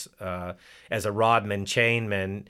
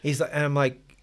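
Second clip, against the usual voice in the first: usual speaking voice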